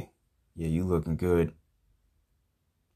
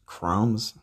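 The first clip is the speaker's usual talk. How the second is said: ordinary talk, nothing marked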